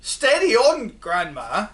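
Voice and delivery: put-on boy calling a warning to his grandma. A narrator's reading. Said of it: "George said".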